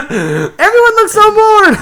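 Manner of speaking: high, loud, drawn-out mock wail; hammed-up frantic realization; panicked inner voice. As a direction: wail it in comic desperation. Ginger's panicked internal dialogue.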